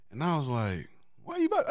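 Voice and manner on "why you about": very high-pitched retold protest; baffled, squeaked-up question; incredulous protest. Man hears woman is leaving the party, high incredulous.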